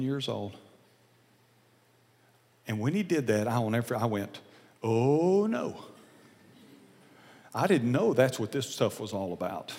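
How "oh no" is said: drawn-out "oh" gliding up high; mock dread, stretched and rising; dismayed. Thirteen-year-old asked to preach, drawn-out dismay.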